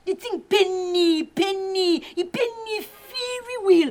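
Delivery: drawn-out, rising lament; anguished complaint. Acted. Lamenting how much it hurts.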